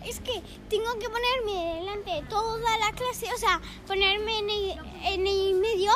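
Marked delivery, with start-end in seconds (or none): none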